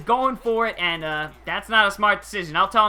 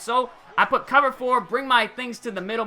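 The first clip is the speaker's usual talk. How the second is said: ordinary delivery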